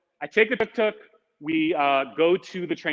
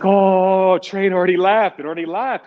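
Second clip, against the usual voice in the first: louder, higher flat news; blunt guard; 3 semitones higher, 6 dB louder than usual. Retold or imitated. Guard telling late traveler the train left, loud and blunt. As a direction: imitate a guard stating the obvious.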